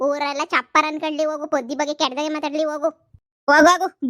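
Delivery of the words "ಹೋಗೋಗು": angry shout. Acted. Driving her away in a quarrel.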